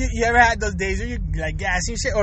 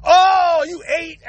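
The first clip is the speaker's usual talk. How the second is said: high, loud, gleeful disbelief; shocked outburst; 4 semitones higher, 4 dB louder, much slower than usual. Acted. Teasing a friend about his confession, loud and shocked. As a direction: burst out at the gross reveal.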